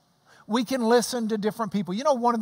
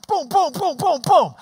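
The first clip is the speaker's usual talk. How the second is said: loud, higher boom claps; imitated claps; 6 semitones higher, 6 dB louder than usual. Preacher mimicking the coach's claps, loud. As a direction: beat out the claps with your voice. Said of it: "He's clapping".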